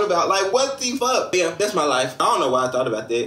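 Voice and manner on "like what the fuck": exasperated. Real, not acted. Outraged at dad.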